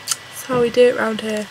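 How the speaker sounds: normal talking voice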